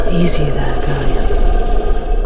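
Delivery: calming call. Acted. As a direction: raise your voice to steady the creature. Calming the dragon over noise.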